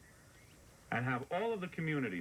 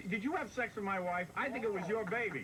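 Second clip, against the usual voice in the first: mock accusation; 6 semitones higher than usual.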